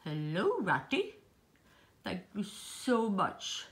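[name]'s voice. Pretend. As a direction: drop it down for a talkative boy doll. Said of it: "Take two".

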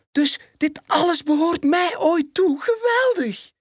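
veel hoger en luider, opgetogen welpenstem; excited cub exclaiming. Acted. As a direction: roep het uit als een verrukt jong leeuwtje. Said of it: "roept Simba uit". (welp hoort dat hij koning wordt, uitbundig hoog)

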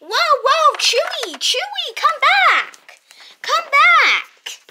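high, loud frantic calls; calling out. Pretend. Owner calling back the escaping dog, loud. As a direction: yell after the runaway dog.

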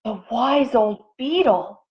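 put-on voice, mildly gasped; gasping. A narrator's reading. Animals hear of the wise old beetle, mildly gasping. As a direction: say it with a touch of awe. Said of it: "gasped the animals".

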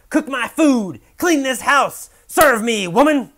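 high, loud bossy commands; domineering husband barking orders; authoritarian husband. A narrator's reading. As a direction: order the wife around like a tyrant. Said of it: "Bob will be an authoritarian husband".

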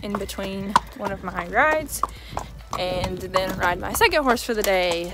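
ordinary talk

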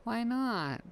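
put-on little girl voice.

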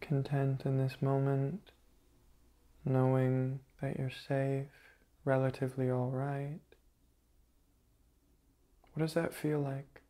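soft, level meditation voice; guided meditation. Acted. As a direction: softly reassure the meditators, pausing between phrases.

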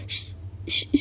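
a frightened shh under the breath; hushing whisper. Acted. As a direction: hush him urgently in a whisper. Frightened girl hushing someone. (பயத்தில் கிசுகிசுப்பு)

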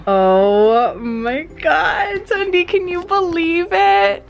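high, thrilled disbelief; squealing with excitement; excited. Real, not acted. Subscriber count nearly hits milestone.

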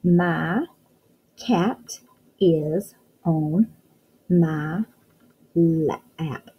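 My cat is on read word by word very slowly; slow sounding-out reading. Shown as a demonstration. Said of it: "Let's read".

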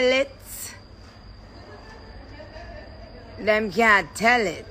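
a playful Jamaican patois put-on, slow and drawn out. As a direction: slip into a joking Jamaican accent. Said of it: "why wouldn't Jamaicans be talking like that".

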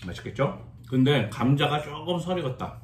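talking around a mouthful; chewy muffled words; mouth full, chewing. Real, not acted. Seen 1: Takes bite at -1.0, cheeks full and chewing during speech.

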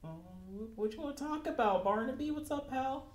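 high, chummy fake-friendly voice; nervous toy maker acting casual; cheery Benson. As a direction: play it falsely friendly and light. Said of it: "he's just like".

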